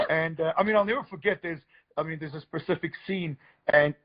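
ordinary delivery

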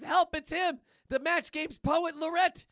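high, loud cry for help; hammed-up cartoon alarm; fleeing in panic. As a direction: call out as if being chased. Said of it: "Milk is running from Cheese".

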